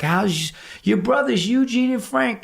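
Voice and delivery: lightly voiced polite old lady; mock sweet old woman. Old woman asks about his brothers, mock sweet. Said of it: "she's like".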